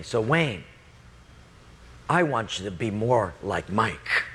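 low, measured, deliberate; lightly voiced divine instruction with a pause; God. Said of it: "He said to me".

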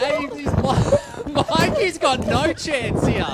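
excited play-by-play call of a sprint; race commentary. Acted.

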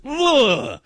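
long, drawn-out "ugh"; disgusted groan. Real, not acted. Host grossed out by food-commercial talk, disgusted groan. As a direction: groan in revulsion, stretched out.

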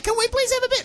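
hammed-up pleading kids; girls pleading. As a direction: squeak it like little kids begging. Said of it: "the girls are like".

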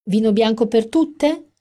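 high, loud chic calling. A narrator's reading. Amanda calling drink orders loudly across the bar. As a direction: project across the room. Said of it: "parlava sempre a voce altissima".

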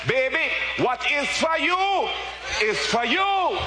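put-on mother's Jamaican accent, high and loud. Preacher quoting his mother's saying to church, high Jamaican accent. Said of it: "in her Jamaican accent".

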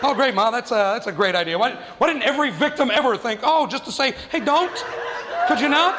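high-pitched incredulous mockery of mom's idea; exasperated son's mock amazement; sarcastic. Son mocks mother's useless idea.

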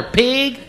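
Pig for Peg, high mocking pitch; Texan pronunciation. Shown as a demonstration. Comedian teasing the Texan, saying Peg as Pig high. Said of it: "How do you say the woman's name".

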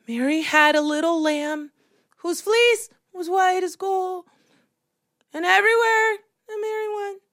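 imitated small girl with mild nerves; a little nervous. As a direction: recite timidly but get through it. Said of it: "she was a little nervous".

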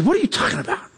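mild mock bewildered spouse; imitating baffled wife. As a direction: mimic her confused question. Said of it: "She says".

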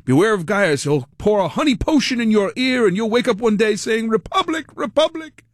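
quoting Commodus with a mocking high finish; Commodus impression. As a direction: sneer the warning, then mock the word Republic up high. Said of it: "This is Commodus".

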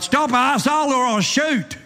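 hammed-up sergeant threat with a laugh; cried warning. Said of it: "and cried".

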